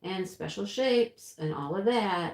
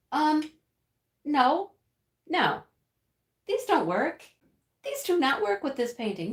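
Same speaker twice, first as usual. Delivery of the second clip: higher and louder, retelling her annoyed no, no; exasperated rejection; 6 semitones higher, 4 dB louder than usual. Retold or imitated. Telling chat about the wrong drills, mock exasperated. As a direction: replay the moment she found the wrong drills, fed up. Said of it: "I'm like".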